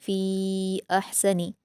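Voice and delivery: फ़ी stretched long before अहसनी; फ़ी drawn out in madd munfasil; four-count elongation. Shown as a demonstration. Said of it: "चार हरकत के बराबर खींचेंगे".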